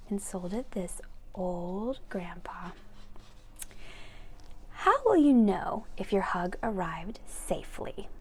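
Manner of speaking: gentle unvoiced story whisper; whispered reading. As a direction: lean in and whisper the instruction.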